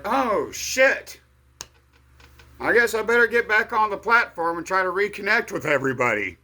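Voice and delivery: loud, high-pitched startled reaction; alarmed realization. Retold or imitated. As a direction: act out a sudden jolt of realization. Acting out his reaction to earthquake news.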